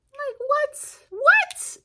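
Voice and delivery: very high, squeaky disbelief; incredulous. Real, not acted. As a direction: shriek of total disbelief. Outraged at the nurse.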